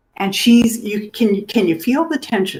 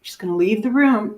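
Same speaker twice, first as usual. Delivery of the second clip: normal talking voice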